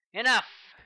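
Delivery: sharp, loud, high bark of a command; abrupt. Acted. Colonel ending the meeting, abrupt loud shout. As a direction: cut her off hard, loud and high. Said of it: "he said abruptly".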